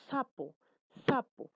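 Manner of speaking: "sapo" with the hissed "s" pushed out; fricative demonstration. Shown as a demonstration. Teacher showing small puffs of air, emphatic demonstration of "sapo". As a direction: model "sapo" carefully, firmer on the repeat. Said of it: "también sale con alguna dificultad".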